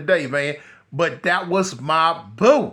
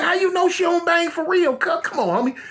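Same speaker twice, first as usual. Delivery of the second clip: much higher, skeptical question; retold disbelief; 9 semitones higher than usual. Doubting Keith's girl, high skeptical question. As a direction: come on, you serious.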